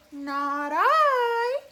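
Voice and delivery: high, loud meowing whine; whiny cat. A narrator's reading. Cat refusing to help the hen, high whiny voice. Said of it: "said the cat".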